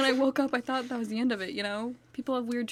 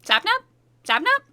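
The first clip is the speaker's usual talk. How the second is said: calling out; 9 semitones higher, 8 dB louder than usual. Acted. Call cut off.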